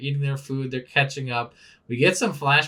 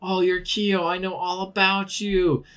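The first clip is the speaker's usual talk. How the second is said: higher, lightly voiced cheeky kid; 7 semitones higher than usual. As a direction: play a smug little kid. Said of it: "Consome's like".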